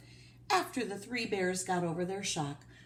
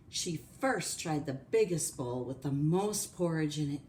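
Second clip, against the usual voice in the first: usual speaking voice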